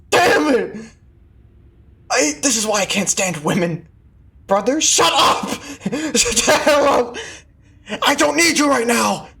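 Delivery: furious yelling. Acted. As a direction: yell it out, half laughing. Angry outburst in dialogue.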